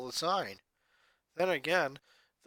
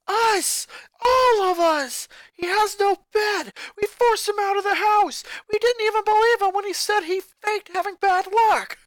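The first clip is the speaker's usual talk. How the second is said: high, loud anguished yelling; desperate shouting; 9 dB louder than usual. Acted. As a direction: shout it out in guilty panic. Guilt-stricken sister shouting.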